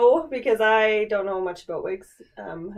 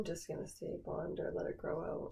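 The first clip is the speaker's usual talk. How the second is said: whisper; 4 semitones lower, 16 dB quieter than usual. Real, not acted. Aside during hair talk.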